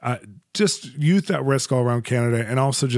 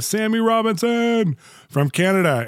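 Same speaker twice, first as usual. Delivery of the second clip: drawn-out, much higher announcer lift on the name; excited introduction; 9 semitones higher, slower than usual. Real, not acted. Podcast host proudly announcing his dear friend. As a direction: sell the guest's name like a big arrival.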